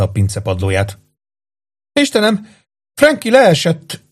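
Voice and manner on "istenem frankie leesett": high, slow alarmed cry; cries out. A narrator's reading. Mother cries out as her son falls, high and slow. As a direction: stretch it out high like a staged alarm. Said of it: "kiáltotta Deborah Ann".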